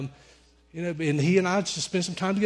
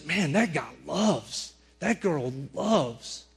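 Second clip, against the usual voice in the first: slow, with "loves" drawn right out; admiring emphasis; 4 dB quieter, much slower than usual. Retold or imitated. Onlookers marvelling at how someone loves, admiring emphasis. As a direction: stretch each "loves" long in admiration.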